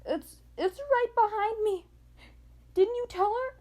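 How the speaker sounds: high, loud urgent yelling; panicked yell. A narrator's reading. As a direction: yell a frantic warning. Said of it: "he yelled".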